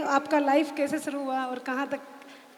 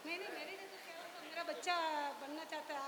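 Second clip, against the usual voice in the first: cave echo; 18 dB quieter, faster than usual. Real, not acted. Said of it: "इधर इको sound आ रहा है".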